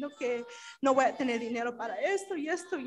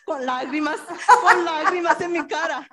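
louder, laughing as the words come out; real laughter over a tearful memory; laughing through tears; 3 semitones higher, 10 dB louder than usual. Real, not acted. Laughing while telling of tears. (riendo entre lágrimas, más fuerte)

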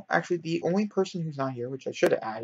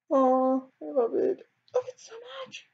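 squeaky gush; 13 semitones higher, slower than usual. Real, not acted. Gushing over her sim.

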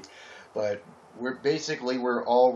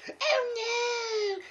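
squeaky high oh no; cartoon pony suddenly remembering; shrill-free high cry of alarm; high panicked pony yell. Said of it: "she yelled".

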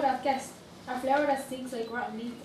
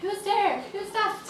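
startled; 8 semitones higher, 4 dB louder, slower than usual. Acted. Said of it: "Lolita felt a little afraid".

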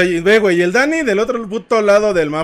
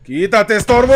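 angry shout; 5 semitones higher, 7 dB louder than usual. Real, not acted. Teammate blocking the way.

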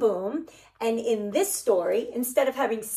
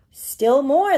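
gasping, louder, playful surprise; mock surprise with a gasp; 4 semitones higher, 7 dB louder than usual. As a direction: gasp as if amazed for the kids. Letters keep climbing the tree.

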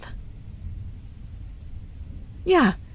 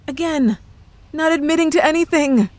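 playful coy denial; 7 semitones higher, 4 dB louder than usual. Acted. Playful denial of being witch.

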